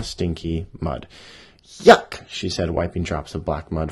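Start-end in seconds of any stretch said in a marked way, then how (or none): 1.6-2.3 high, loud squeal of disgust; disgusted yuck. Acted. Bunny finds mud in her whiskers, loud high yuck.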